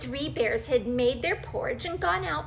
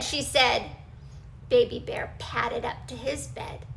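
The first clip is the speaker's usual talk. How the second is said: ordinary delivery, nothing marked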